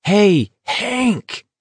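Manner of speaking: high, insistent hail; shouted call. A narrator's reading. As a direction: call out high, but not at full volume. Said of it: "McVrees shouted, ignoring Baker".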